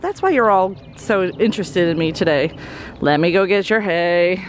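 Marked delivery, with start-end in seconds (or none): none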